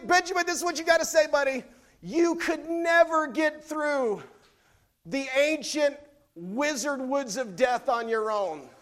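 put-on high, loud, swaggering kid voice; boastful kid Benjamin. Preacher voicing a boastful son to his siblings. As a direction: puff up like a proud little brother. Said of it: "here's what he".

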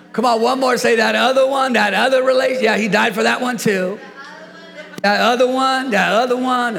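high, loud, driving preacher; full-force preaching. Real, not acted. Preacher urging neighbours to tell each other, full-force preaching. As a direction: preach it up to the congregation.

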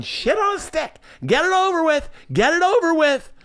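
very high imitation of mom through a smile; mock-queasy mother willing it to end; smiling, enduring mom. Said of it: "my mom is smiling, eyes closed".

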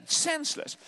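ordinary delivery

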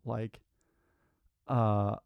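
ordinary delivery

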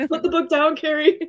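high playful command; mock pleading. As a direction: tease like a frantic fan. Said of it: "You're like".

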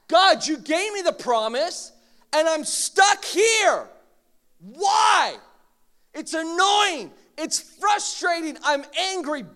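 loud high venting to God; honest frustrated prayer. Shown as a demonstration. Preacher modeling honest prayer, loud frustrated venting. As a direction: pour the frustration out.

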